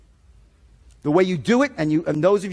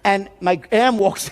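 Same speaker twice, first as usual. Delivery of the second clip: ordinary talk, nothing marked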